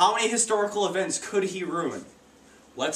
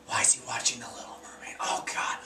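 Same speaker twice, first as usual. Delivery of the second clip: a hushed, shocked whisper; judging whisper; 7 semitones lower, 8 dB quieter than usual. Retold or imitated. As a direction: whisper it like gossip behind his back. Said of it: "They're like".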